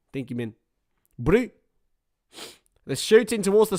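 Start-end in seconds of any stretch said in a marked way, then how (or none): 0.1-1.5 grateful words in a put-on South African accent. Shown as a demonstration. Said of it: "praise from David for one of my accents".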